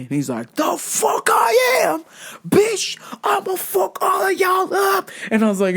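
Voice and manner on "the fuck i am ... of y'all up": much higher, slow screamed threat; furious shout. Retold or imitated. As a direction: scream it at the managers. Man screams at managers.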